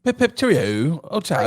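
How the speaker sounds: high, loud caricature British; British accent. Pretend. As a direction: play up a jolly cartoon Brit. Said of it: "give me your British accent".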